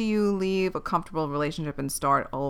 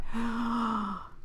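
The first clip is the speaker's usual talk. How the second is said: dramatic gasp; 3 semitones higher, 8 dB quieter than usual. Real, not acted. Gasp before sharing a secret.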